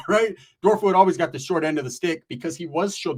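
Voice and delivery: normal talking voice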